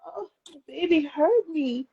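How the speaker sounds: sudden realization. Retold or imitated. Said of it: "Because I was like".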